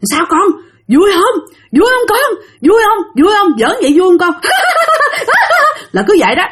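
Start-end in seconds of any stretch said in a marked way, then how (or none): 0.0-4.4 high, gleeful ghostly cooing; ghost mother's taunt. Retold or imitated. Ghost mother asks her child if it's fun, high and taunting. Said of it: "nghe tiếng cái bà đó nói vậy nè". 4.5-5.9 very high put-on ghost cackle; ghostly laughter. Ghost mother and child laugh after the fleeing man, shrieking high cackle. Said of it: "nghe tiếng cười của hai mẹ con nó".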